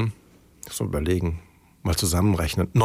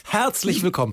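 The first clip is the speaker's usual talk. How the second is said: higher, copying the show intro tone; imitating TV host greeting; 8 semitones higher than usual. Shown as a demonstration. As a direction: echo the host's greeting. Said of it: "Ich versuche genau in dem gleichen Ton zu machen".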